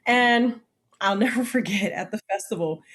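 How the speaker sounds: ordinary delivery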